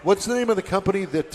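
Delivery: ordinary delivery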